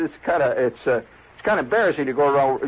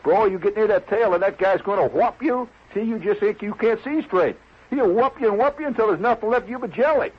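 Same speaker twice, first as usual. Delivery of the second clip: higher-pitched, insistent warning; worked-up warning; 6 semitones higher than usual. Acted. Club member warns guest what the tail would do, worked up. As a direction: make the threat vivid and urgent.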